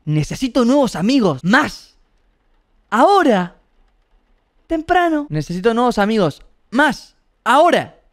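a prince shouting out his demands. A narrator's reading. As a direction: bark it like a spoiled prince. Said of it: "El príncipe gritó".

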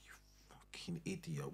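very soft, whispered reproach; muttered insult. Retold or imitated. Partner scolding him for losing the spider, hushed. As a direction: whisper it as a retold put-down.

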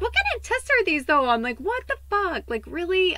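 high, loud mock outrage at Spirit; shrill fed-up complaint to the heavens; exasperated outburst. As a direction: hurl it up at the sky in disbelief.

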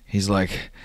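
ordinary talk, nothing marked

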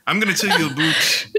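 hammed-up Canadian "a boot"; badly faked Canadian accent. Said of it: "your Canadian accent is horrible".

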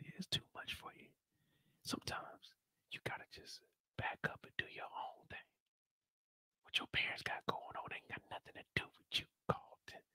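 soft unvoiced mock whisper; imitating a therapist whispering guidance. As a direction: breathe the advice secretively. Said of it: "like you're whispering".

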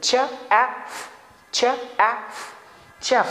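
चेफ sounded ch plus एफ, mispronounced for chef; child's wrong sounding out. Retold or imitated. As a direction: imitate the misreading of chef. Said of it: "बच्चा इसको चेफ pronounce करे".

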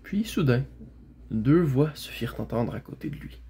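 ordinary delivery, nothing marked

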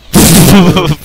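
very loud burst of laughter. Real, not acted. Player laughing as teammate gets pulled, loud laugh. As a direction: explode into laughter.

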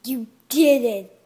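ordinary delivery

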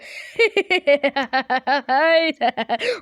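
loud, prolonged laughter; uncontrolled giggling burst; long laughing fit. Real, not acted. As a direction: laugh helplessly for several seconds.

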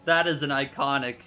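normal talking voice

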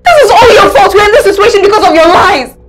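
loud, heated yelling back; angry shouting. Acted. Wife yelling at husband about his lies. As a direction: shout the blame right back at him.

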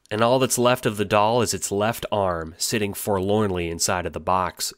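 normal talking voice

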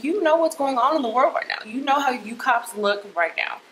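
impassioned, high-pitched accusation; heated protest. Retold or imitated. Retold argument with officer.

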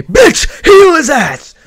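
high, loud squeaky shout; hammed-up high-pitched yell; mock yell as Grimmjow. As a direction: screech the order like a brat. Grimmjow yelling at Orihime.

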